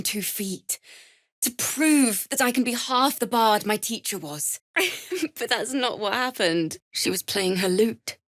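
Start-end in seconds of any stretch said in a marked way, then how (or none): 4.7-5.3 high short laugh. Acted. Bard laughing at herself.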